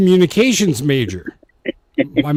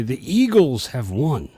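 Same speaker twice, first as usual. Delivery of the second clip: usual speaking voice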